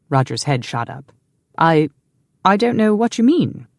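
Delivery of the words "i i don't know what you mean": flustered. Acted. Caught off guard, stammering.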